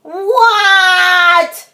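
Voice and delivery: long, high, loud reply; drawn-out yell; yelling back. Acted. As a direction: holler back like a pestered kid. Child yells back at dad.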